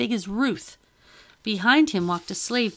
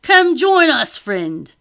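high, loud hail falling on the last word; loud, high-pitched summons to a friend; calling out; 7 semitones higher, 12 dB louder, much slower than usual. A narrator's reading. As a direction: call him over with a high shout. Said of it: "called, waving his walking stick".

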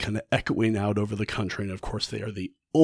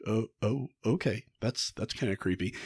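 nervous murmur; 10 dB quieter than usual. Retold or imitated. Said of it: "and she's like".

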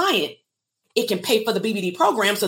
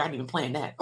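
soft, much quieter laughing aside; laughing at herself; 6 semitones lower, 6 dB quieter, much faster than usual. Real, not acted. Host laughs at her own accidental rhyme for viewers, soft and amused. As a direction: chuckle through it, pleased with the accidental rhyme.

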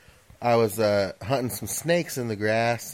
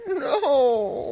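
much higher laughing mock wail; a cry of dismay, half laughing; anguished no. Shot the dog by mistake.

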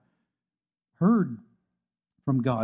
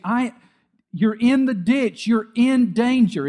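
loud warning; 7 semitones higher, 6 dB louder than usual. Acted. God's warning voiced loudly.

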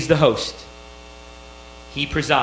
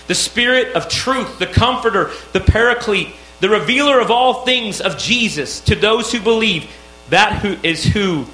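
higher, louder preaching build; rising preaching; 5 semitones higher, 4 dB louder than usual. Acted. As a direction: proclaim each title of the Spirit with rising force. Rising proclamation of the Spirit.

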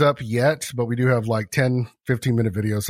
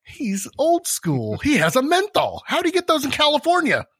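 amazed onlooker; 12 semitones higher than usual. Retold or imitated. Coworker marvelling at his menthol cigarette, higher and amazed. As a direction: marvel at the old-school smoker. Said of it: "It's all".

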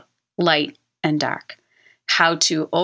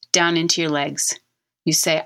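usual speaking voice

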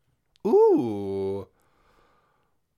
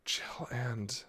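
whispering; 14 dB quieter than usual. Real, not acted. Whispering to herself while guessing.

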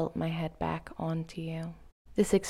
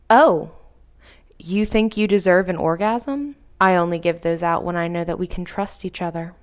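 louder, commanding and teasing; dominant teasing; 8 dB louder than usual. Acted. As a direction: tease her from above. Dominant partner teases her.

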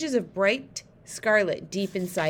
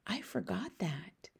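slow, near-whispered admission; hushed murmur; 11 dB quieter, slower than usual. A narrator's reading. Sophie apologises inside the giant's ear, hushed murmur. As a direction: drop to a soft, breath-only murmur. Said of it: "Sophie murmured".

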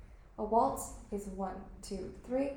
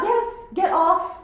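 high, loud push-away; panicked; 8 semitones higher, 10 dB louder, much slower than usual. Acted. Girl struggling free from a boy's grip, panicked. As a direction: shove him off in panic.

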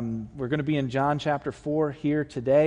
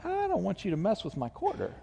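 stubborn self; 5 semitones higher, 6 dB quieter than usual. Retold or imitated. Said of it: "And I'm like".

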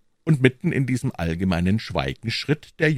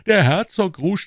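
higher, exclaimed relish; raised, amused exclamation; delighted repeat; 11 semitones higher, 6 dB louder than usual. A narrator's reading. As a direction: savour the joke out loud. Porthos goes on cheering the joke.

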